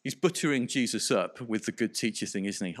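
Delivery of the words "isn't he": ordinary talk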